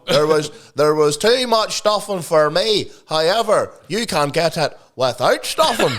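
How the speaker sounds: slow, deliberate parody verdict; mock food reviewer. Host parodying a reviewer's verdict on Christmas food, mock reviewer voice. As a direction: deliver a pointless review, nearly laughing. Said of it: "Northern Irish TikTok and Instagram".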